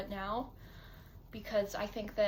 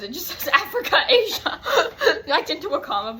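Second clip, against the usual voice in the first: laughing; 7 semitones higher, 16 dB louder than usual. Real, not acted. Reading old video, laughing.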